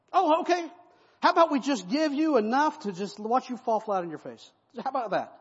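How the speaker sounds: high, loud mock-agreeable sarcasm; sarcastic Joab. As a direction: sneer while pretending to agree.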